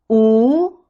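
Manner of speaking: "wu" loud, gliding up; rising tone. Shown as a demonstration. Teacher leads repeat drill, rising wu. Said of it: "The second tone is the rising tone".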